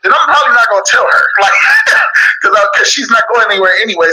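amused, fast confession with laughs; laughing admission. Real, not acted. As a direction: rush it out through a laugh. Laughs at own candid answer.

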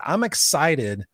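stretched out slowly, savoring each word; slow, deliberate excitement. Retold or imitated. Dad on the Ferris wheel, coaching himself slowly. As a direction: talk yourself into it, slow and sure. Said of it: "But I said".

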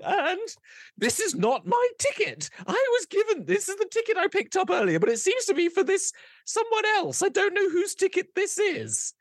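high, pompous noble voice; a grand noble demanding the supervisor; haughty noble voice. Acted. Player character to goblin clerk, pompous high noble voice.

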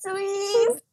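gushing fan. Acted. Gushing fan line, acted.

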